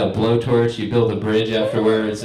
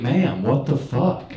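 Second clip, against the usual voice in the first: slow bewildered; slower than usual. Retold or imitated. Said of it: "And I was like".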